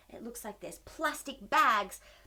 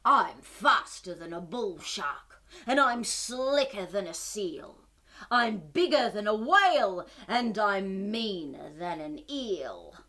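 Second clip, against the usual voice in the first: slow, lower bragging monster voice; boastful monster; 7 semitones lower, 3 dB louder, slower than usual. Acted. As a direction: brag slowly as the trash monster. Monster boasts in picture book.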